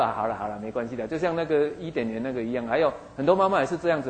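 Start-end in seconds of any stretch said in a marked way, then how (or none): 0.0-1.0 soft-hearted giving in. Retold or imitated. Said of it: "你说：".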